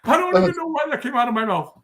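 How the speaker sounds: high, loud, laughing squeal; helpless laughter. Real, not acted. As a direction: barely get the words out through laughter. Cracks up at his own remark.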